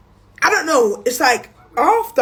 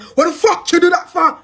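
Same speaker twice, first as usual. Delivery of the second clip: mock yell, higher and louder; retold scream; 7 semitones higher, 5 dB louder than usual. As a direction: shout it like an angry boyfriend woken up. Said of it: "one big screaming behind me".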